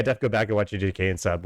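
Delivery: normal talking voice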